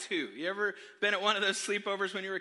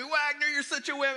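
higher mock-jeering taunt; 6 semitones higher than usual. Buddies punching him after the hang-up, jeering. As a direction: rib him like a rowdy buddy.

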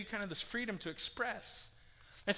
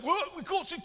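mock old British gentleman, much higher and louder; bad British accent; 11 semitones higher, 4 dB louder than usual. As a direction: ham up a stuffy British elder pounding the table. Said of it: "I can't do a very good British accent".

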